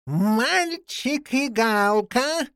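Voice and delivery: very slow, high-pitched proclamation; loud summons. A narrator's reading. Villain's voice from the street summoning boy and jackdaw, proclaiming. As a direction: call out each word, long drawn. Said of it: "раздался на улице громкий голос".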